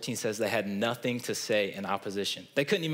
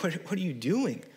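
incredulous; 7 semitones higher than usual. Retold or imitated. Exasperated reaction to Sanhedrin.

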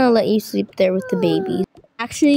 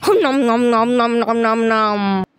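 droning pretend chomps; zombie munching. Zombie gobbles up the sleepers, mock munching. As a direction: chant the chewing noises in a steady run.

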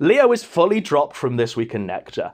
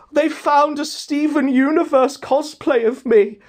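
whiny Leo impression; 9 semitones higher than usual. Whining Leo quoted.

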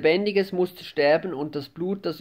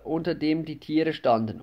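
ordinary delivery, nothing marked

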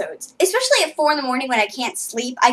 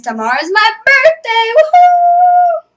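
high, loud mock whoop; fake excitement; 12 semitones higher, 7 dB louder than usual. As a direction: cheer it like a forced party. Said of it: "That was fake excitement".

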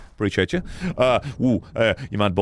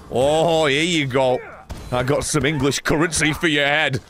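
ordinary talk

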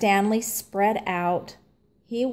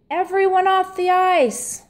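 higher, louder call to the skaters; coach's call; 9 semitones higher, 3 dB louder than usual. A narrator's reading. Coach Bart calls the skaters off the ice, higher and louder. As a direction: clear the ice, coach calling.

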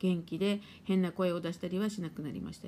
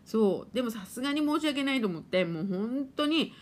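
ordinary delivery

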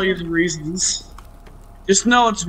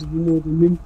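ordinary delivery

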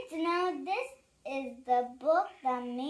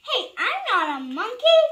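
higher, loud indignant denial; indignant protest; 6 semitones higher, 9 dB louder, faster than usual. Acted. Reader denying he is a monkey, indignant. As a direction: protest it loudly.

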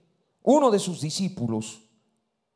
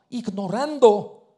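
usual speaking voice

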